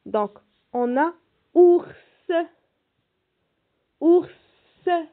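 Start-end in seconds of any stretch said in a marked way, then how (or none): 1.5-2.3 OURS loud and high, final S set apart; stressed final S. Shown as a demonstration. Teacher asks children to listen for S in ours, stressed final S. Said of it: "Si on entend le son S". 4.0-5.1 "Ours" loud and high, then the hissed S repeated alone; isolated S sound. Shown as a demonstration. Teacher helps children hear the S in ours, isolated S sound. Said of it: "Est-ce qu'on entend le S?".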